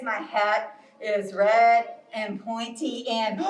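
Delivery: low, slow bear voice. Acted. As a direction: describe it slowly, down low as the bear. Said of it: "so Bear says".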